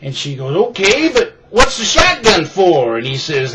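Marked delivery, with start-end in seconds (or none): 0.7-3.0 higher, louder put-on lady voice; puzzled lady. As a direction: ask it like a baffled housewife. Lady's puzzled question, punchline setup.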